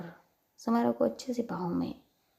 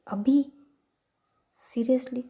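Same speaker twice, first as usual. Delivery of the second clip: higher, louder shocked disbelief; 4 semitones higher, 6 dB louder, slower than usual. Retold or imitated. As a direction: blurt it out in shock. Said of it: "तो shock.". (चौंककर ऊँची आवाज़ में)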